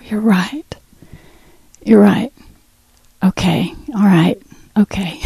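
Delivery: soft whispered yielding, barely voiced; a hushed, humbled okay; whispered surrender. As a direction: give in under your breath. Surrendering softly to God.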